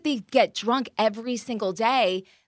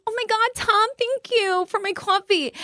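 put-on much higher, gushing girlish voice; 10 semitones higher than usual. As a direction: gush over the coffee like a sweet girlfriend. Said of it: "she's like".

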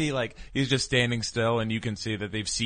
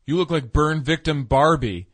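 higher, louder sneering jab; mock mean insult; 7 semitones higher, 7 dB louder than usual. Quoting a film bully, a higher, louder sneering jab. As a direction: fling the insult like a movie bully.

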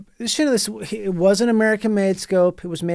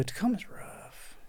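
whisper; 3 semitones higher, 16 dB quieter, slower than usual. Shown as a demonstration. Repeated as whispered aside.

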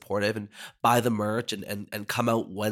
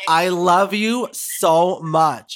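loud, high, very drawn-out words; emphatic gushing; 5 semitones higher, 6 dB louder, much slower than usual. Real, not acted. Drag queen to devoted fan, emphatic gushing. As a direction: gush it slowly, leaning on every word.

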